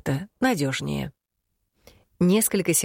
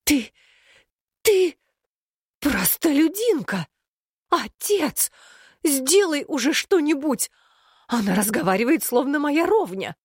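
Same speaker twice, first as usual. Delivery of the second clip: high, tearful outraged shriek; hysterical outrage; 10 semitones higher than usual. Acted. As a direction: sputter with royal fury. Princess hysterical at commoner.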